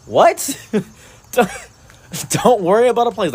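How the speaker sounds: high, laughing what-are-you-saying; laughing in high disbelief at the chef's offer. Real, not acted. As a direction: crack up at how absurd it is. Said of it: "reacts laughing to the chef".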